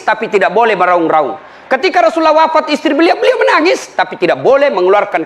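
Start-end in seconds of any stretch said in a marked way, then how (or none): none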